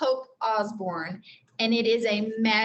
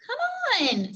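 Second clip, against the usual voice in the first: much higher, bright Annie voice; eager little girl; 14 semitones higher than usual. Acted. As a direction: pitch up high to play the little sister. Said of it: "said Annie".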